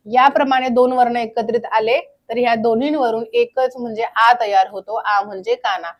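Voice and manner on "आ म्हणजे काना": ordinary talk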